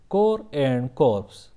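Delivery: Corps said slowly as "core", silent p and s; slow pronunciation demo. Shown as a demonstration. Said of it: "Corps means a group of people". (Corps said slowly as "core", with no p and no s)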